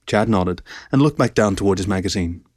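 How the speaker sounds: ordinary delivery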